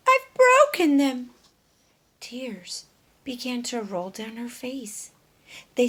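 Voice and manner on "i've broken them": high, loud distressed cry; hen's panicked, much louder wail. Acted. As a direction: cry out in horror at the damage. Dora distressed over eggs.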